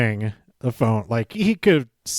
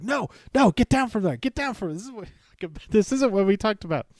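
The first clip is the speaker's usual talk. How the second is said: higher, panicky pleading, fading to a fretful mumble; a coach panicking at his climber; mock freaking out; 7 semitones higher, 4 dB quieter, faster than usual. Drew Gulak begs Heath off the top rope in mock panic. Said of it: "Drew Gulak starts freaking out".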